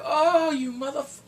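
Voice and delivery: loud, high, drawn-out outrage; outraged cursing. Real, not acted. Viewer reacting as he takes her, loud outraged cursing.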